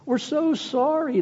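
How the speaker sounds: high, slow fearful plea; fearful pleading. Retold or imitated. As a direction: beg like a frightened student. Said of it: "quaked in fear".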